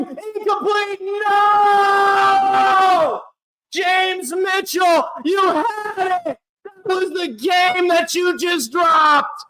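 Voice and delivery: high, slow, near-tearful yelling; anguished shouting. Real, not acted. Fan yelling at James Mitchell for the dropped ball, anguished shouting. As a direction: yell at the player who dropped it. Receiver drops the winning catch.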